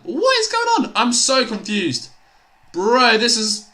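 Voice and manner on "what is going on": squeaky cracked disbelief. Real, not acted. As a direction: voice cracking up high in real disbelief. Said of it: "Nice voice crack".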